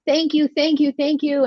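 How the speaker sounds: high, loud imitation of gratitude; effusive older couple. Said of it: "They were just like".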